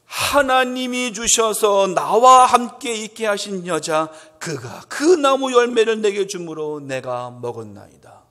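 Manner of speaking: slow, loud pleading excuse that fades at the end; Adam shifting the blame, drawn out; a hint of a guilty Adam; Adam's excuse. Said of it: "그때 아담이 뭐라고 말하는지".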